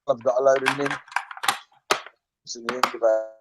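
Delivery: audio dropping in and out; cutting out. Real, not acted. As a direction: voice fading on a bad connection. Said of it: "You keep going quiet".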